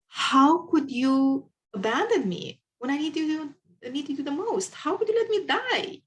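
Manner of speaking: man before God, high and anguished reproach. Retold or imitated. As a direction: cry out to God, betrayed. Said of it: "extremely disappointed and actually quite mad with God".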